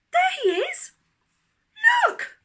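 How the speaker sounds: put-on high, loud urgent child; excited call. A narrator's reading. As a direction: shout and point. Daisy pointing at rhino.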